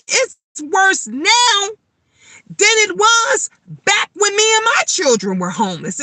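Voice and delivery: pleading urgency, each word pushed; high impassioned plea. Real, not acted. Telling viewers about her homeless years, high impassioned plea.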